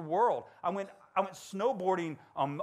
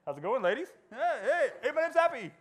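hammed-up smooth guy, higher and louder; cheesy flirt; 9 semitones higher, 5 dB louder than usual. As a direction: ham up a ladies' man line.

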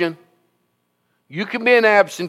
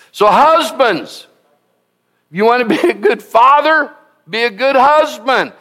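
preaching exhortation; 6 semitones higher than usual. Real, not acted. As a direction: lean in and exhort the husbands.

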